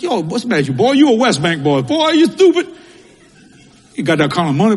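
imitating a mother scolding her boy, rising to a high loud jab; mock scolding from Mama, peaking high and loud on "Boy, you stupid". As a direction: son mimics his mother scolding him. Mother scolding son in story.

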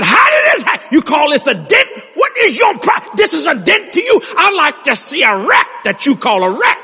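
high, outraged yelling retold in full force; furious shout. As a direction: explode in disbelief at the driver. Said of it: "I screamed at him".

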